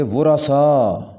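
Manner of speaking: ورثاء slow in three syllables: wu-ra-saa; correct pronunciation. Shown as a demonstration. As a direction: sound the "ra" and stretch the end of ورثاء. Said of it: "اس کو پڑھیں گے". (ورثاء کا صحیح تلفظ، "وُرَثا")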